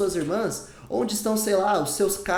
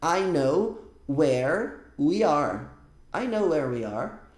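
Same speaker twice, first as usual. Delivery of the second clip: "I know where we are" stretched very slowly; slow classroom model; slow model sentence; 2 semitones lower, much slower than usual. Shown as a demonstration. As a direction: spell it out for students. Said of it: "por exemplo".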